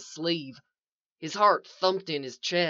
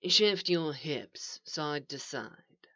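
very slow, soft, low guidance; calm coaching; 3 semitones lower, 6 dB quieter, much slower than usual. A narrator's reading. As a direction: coach them gently, word by word. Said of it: "the voice coached him".

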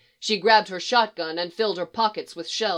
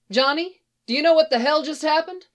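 higher, fast urgent question; urgent call; 7 semitones higher, 4 dB louder than usual. Acted. Wife calls to husband after the fight, higher and urgent. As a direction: call out to him, rattled.

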